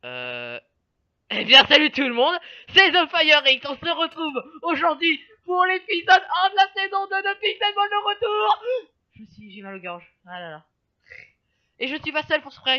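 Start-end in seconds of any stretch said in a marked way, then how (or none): 1.3-8.6 energetic intro. Real, not acted.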